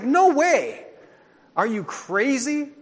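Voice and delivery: high, loud disbelieving outburst; mock outraged no way; incredulous refusal. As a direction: recoil at the idea like it's absurd. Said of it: "I'm like".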